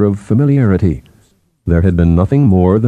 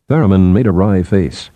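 ordinary delivery, nothing marked

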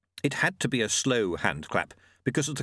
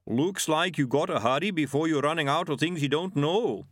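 bigfoot; 5 semitones higher than usual. Acted. Narrator voices the Yeti.

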